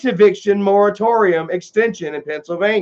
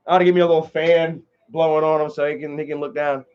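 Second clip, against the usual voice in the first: ordinary talk, nothing marked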